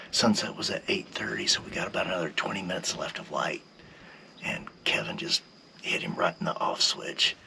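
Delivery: soft, breath-only recap; hushed hunting-blind narration; whispering. Real, not acted. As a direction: whisper the story of the shot to camera. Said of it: "I don't know why we're whispering now".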